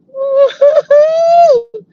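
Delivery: loud, drawn-out "woo-hoo" laugh; real high-pitched holler of amusement; high whooping laugh. Real, not acted. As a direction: whoop it out high and long, tickled.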